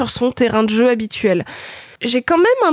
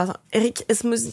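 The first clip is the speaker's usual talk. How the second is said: stuffy nose, stumbling on the name; blocked nose; 7 dB quieter than usual. Real, not acted. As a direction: talk through a blocked nose. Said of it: "j'ai le nez bouché".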